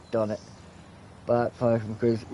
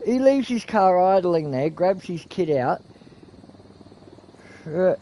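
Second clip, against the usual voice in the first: ordinary talk, nothing marked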